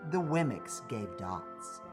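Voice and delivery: usual speaking voice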